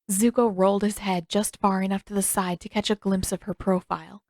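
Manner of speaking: ordinary delivery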